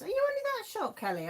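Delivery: genuinely surprised, high question. Real, not acted. Host surprised to hear viewer is short, high and amazed. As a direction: pitch leaps up in disbelief.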